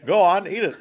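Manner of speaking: loud order broken by laughter; laughing mock order. Friend echoing the order while laughing, loud mock shout.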